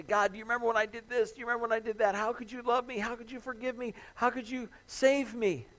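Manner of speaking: high, anguished pleading; pleading cry to God. Retold or imitated. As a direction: beg God desperately, voice lifted high. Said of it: "you cry out to God".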